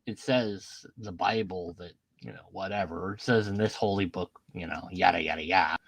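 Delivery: low, soft dismissive mock believer voice. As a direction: brush it off like a smug believer. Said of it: "you go".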